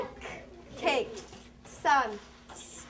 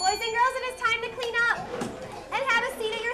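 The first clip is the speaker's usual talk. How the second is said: raised, higher teacher voice, a bit fast; calling out to class; 9 semitones higher, 5 dB louder than usual. Real, not acted. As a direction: call the whole class to tidy up.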